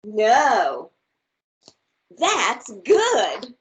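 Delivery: lively, expressive storybook read; reading to children. Acted. Teacher reads a picture book aloud to her class, bright and expressive. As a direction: read it big and playful for little listeners.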